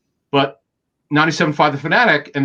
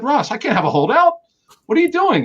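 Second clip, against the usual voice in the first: alarmed protest; 10 semitones higher than usual. Retold or imitated. Protesting to agent Russ about a holdout, alarmed. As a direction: squeak out your panic at the agent.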